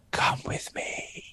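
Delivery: slow, breathless whisper; eerie whisper. Retold or imitated. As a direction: whisper it slowly like a ghost child. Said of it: "whispering, I can hear someone saying".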